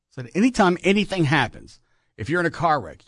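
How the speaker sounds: usual speaking voice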